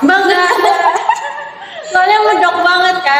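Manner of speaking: high, laughing through the words; proud giggling boast; real laughter with a proud ring; laughing proudly. Real, not acted. Laughing about being Ngapak.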